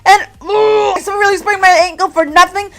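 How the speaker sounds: loud, high outraged yell. Real, not acted. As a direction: yell it, furious at yourself. Outraged about finding ID.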